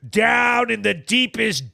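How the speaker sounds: usual speaking voice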